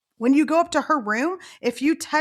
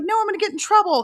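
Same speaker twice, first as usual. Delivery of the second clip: put-on high, fast, louder child's protest; acted kid voice, rushed and pitched up; protesting little kid; 10 semitones higher, 5 dB louder, faster than usual. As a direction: imitate a panicked little boy refusing. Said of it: "He's like".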